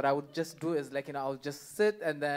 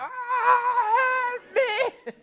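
much higher, crying wail for help; hammed-up tearful plea; mock cry for help; 6 dB louder than usual. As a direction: whimper it like you're trapped. Acting out toilet panic.

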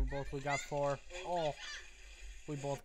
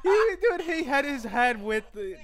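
laughing while talking; 6 semitones higher, 6 dB louder than usual. Real, not acted. Cracking up over the video.

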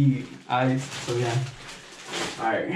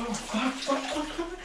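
shivering, rising fucks from the cold; 11 semitones higher, 7 dB quieter, much slower than usual. Real, not acted. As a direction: shiver through the words. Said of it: "I don't feel my legs".

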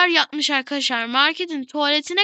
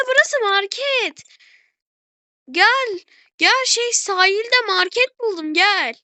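excited calling; 5 semitones higher than usual. Real, not acted. To Gülce about a market on the beach, an excited high call. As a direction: shout the find to a friend.

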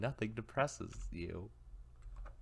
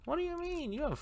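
character voice. Acted. Voicing game character, high.

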